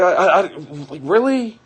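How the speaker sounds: high, loud sputtering disbelief; stunned disbelief. Retold or imitated. Answering his wife's accusation, loud stunned disbelief. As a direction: sputter like you can't believe it. Stunned reply in retold fight.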